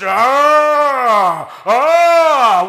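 a high, loud frightened cry; staged haunted-house scream; mock terrified scream. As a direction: let out a scared yell like in the dark. Haunted house monsters attack.

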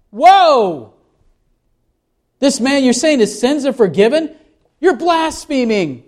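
high, incredulous scandalized scribe; mock-outraged scribe, pitched well up. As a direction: play the shocked religious leader calling it blasphemy. Scribes object to Jesus forgiving sins.

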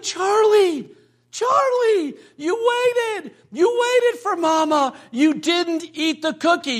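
high, delighted mock-mom voice; mother's excited praise. Mother back in the room praising her waiting son, high excited praise. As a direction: imitate a mom gushing over her little boy. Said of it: "she was like".

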